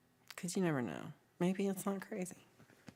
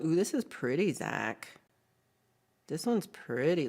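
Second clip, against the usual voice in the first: normal talking voice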